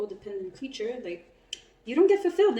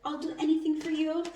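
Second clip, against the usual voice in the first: higher, airy voice of a pushover; mocking a clingy partner, higher; mock eager yes-man; 8 semitones higher than usual. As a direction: play the eager people-pleaser, higher. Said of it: "It just goes like".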